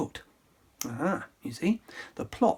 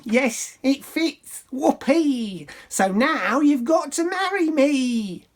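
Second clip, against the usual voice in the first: high, loud gloating squeal; higher, louder ugly sister gloat; triumphant scream; 9 semitones higher, 4 dB louder, slower than usual. Acted. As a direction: crow over winning. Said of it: "she screamed".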